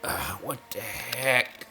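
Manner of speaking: slow breathy whisper; disgusted whisper. Real, not acted. Gamer whispering his disgust.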